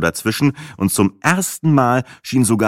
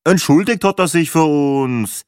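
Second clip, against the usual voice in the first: sniffy Viennese; 6 semitones higher, 3 dB louder than usual. Acted. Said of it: "ebenso verschnupft wie wienerisch".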